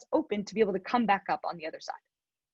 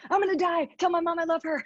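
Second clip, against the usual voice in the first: higher, mock panicked cry; retold panic; 6 semitones higher than usual. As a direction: replay the moment you think you'll die. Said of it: "and I'm like".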